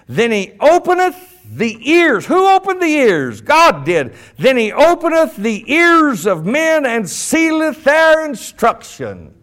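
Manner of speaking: loud, high, emphatic scripture proclamation; ringing, stretched preacher's delivery; forceful preaching. Acted. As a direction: hammer the verse home to the congregation. Preaches the verse at full force.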